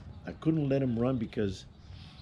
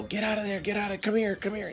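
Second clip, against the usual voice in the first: retold yell at the dog; 11 semitones higher than usual. Owner calling his dog away from a rattlesnake, high-pitched urgent call. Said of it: "And I yell".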